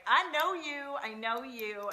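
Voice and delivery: loud, high joyful I know you; excited recognition. Pretend. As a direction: greet a kindred soul with glee. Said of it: "and you're just like".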